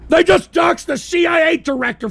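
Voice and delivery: high, loud enraged shouting; real rage; furious yelling. Real, not acted. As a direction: scream at the troll. Streamer raging over doxing.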